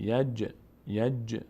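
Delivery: یج with a small bounce on the jim; slight qalqala. Shown as a demonstration. Teacher demonstrating qalqala to students. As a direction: let the jim of یج shake a little. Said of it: "مقداری میلرزه".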